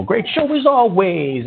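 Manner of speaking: high, slow sneering echo; mocking repeat. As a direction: parrot it back with a sneer. Host mocks the caller's greeting.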